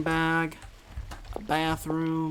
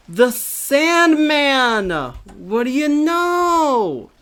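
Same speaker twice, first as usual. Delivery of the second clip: slow, loud, higher drawn-out taunt; menacing stranger; 11 semitones higher, 11 dB louder, much slower than usual. Acted. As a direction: stretch each word like a sinister showman. Voices a menacing figure, drawn out.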